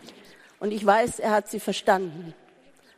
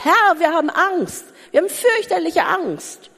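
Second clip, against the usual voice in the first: higher, urgent plea to the Lord; fearful plea; 7 semitones higher, 4 dB louder than usual. Acted. Disciples coming to Jesus with a fearful plea. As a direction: plead with real fear. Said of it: "haben gesagt".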